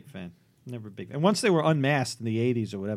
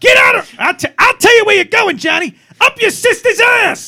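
full-volume, high-pitched grandpa road rage; hammed-up old Italian man shouting curses from the car; angry old Italian man; 13 dB louder than usual. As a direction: yell it high and hard, stretching the final insult. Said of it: "he would be very impatient".

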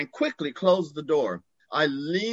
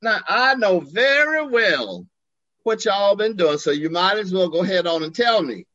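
put-on Southern friend demanding the truth, sassy Southern accent; 4 semitones higher, 4 dB louder than usual. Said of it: "He had a Southern accent".

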